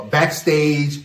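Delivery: normal talking voice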